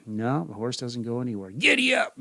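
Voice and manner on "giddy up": much higher, louder urging; frustrated giddy up. Acted. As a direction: push harder on the horse. Rider urging stubborn horse.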